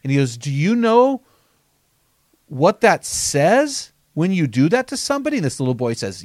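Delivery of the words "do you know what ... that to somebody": high, pressing question; stern pastor. Retold or imitated. Children's pastor pressing the boy, high and insistent. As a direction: lean on the boy with a raised voice.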